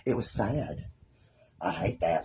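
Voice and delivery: soft, subdued sorrow; low, quiet regret; saddened. Real, not acted. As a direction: let the voice drop in real sadness. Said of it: "that's sad".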